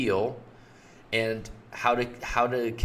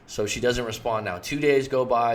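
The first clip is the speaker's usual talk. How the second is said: ordinary talk, nothing marked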